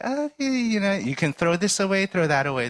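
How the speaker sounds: mostly normal pitch, starting high on oh; lightly voiced cheerful tidying expert; smiling Marie Kondo. Tidying host to hoarder family, lightly voiced smile. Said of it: "really smiling".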